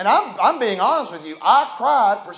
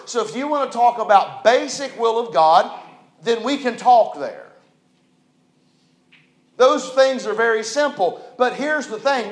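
emphatic, rising sermon delivery, louder; preaching; 3 semitones higher, 7 dB louder than usual. Acted. Preacher to his congregation, preaching emphatically. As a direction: hammer each "we all know" to the congregation. Preacher drives the point home.

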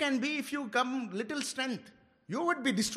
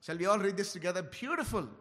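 ordinary talk

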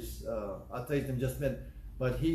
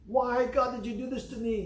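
much higher anguished outcry; angry cry to God; 10 semitones higher than usual. Retold or imitated. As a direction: plead angrily from the hospital bed. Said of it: "He's very, very mad".

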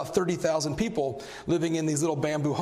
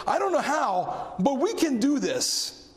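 higher, slow, ringing proclamation; bold declaration; 7 semitones higher, slower than usual. Acted. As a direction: declare it with faith. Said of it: "we have to say".

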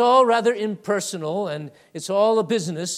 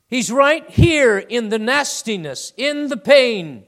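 louder, impassioned, slowing on pain; fervent emphasis; 5 semitones higher, 8 dB louder than usual. Real, not acted. Preacher insists to congregation that Jesus is in the pain, fervent and loud. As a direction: hammer it home with conviction.

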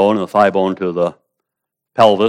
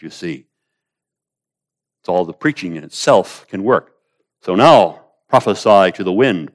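ordinary delivery

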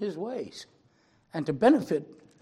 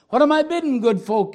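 much louder and higher auctioneer call; auctioneer crying out; 9 semitones higher, 13 dB louder than usual. Acted. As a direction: hawk the old violin to the crowd. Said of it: "he cried".

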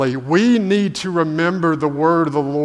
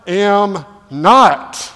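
very slow, louder, drawn-out denial; stark denial; 4 semitones higher, 7 dB louder, much slower than usual. Acted. Peter flatly refusing the bystanders, slow emphatic denial. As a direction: lean hard on each word, refusing. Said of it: "in stark contrast to that".

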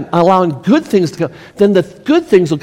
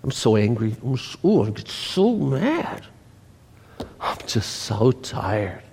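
mock whiny complaining; 7 semitones lower, 7 dB quieter than usual. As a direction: sigh out the tired complaints.

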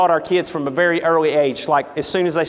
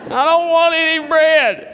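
higher, slow, drawn-out whine; hammed-up petulant wail; more whining Ahab; 9 semitones higher, 3 dB louder, slower than usual. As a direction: stretch the refusal like a sulking child. Ahab refuses bread again.

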